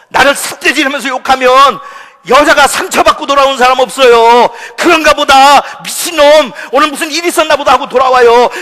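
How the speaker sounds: loud, high, impassioned preacher; full-force preaching. Real, not acted. Preacher warning church members against habitual anger, full-force preaching. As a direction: thunder the warning at the congregation.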